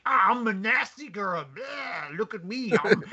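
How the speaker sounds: high, mock-ugly character voice; hammed-up Ginro. Host imitating Ginro posing as a nasty girl, hammed-up high voice. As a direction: ham it up as a disguised boy playing gross. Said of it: "but Ginro's like".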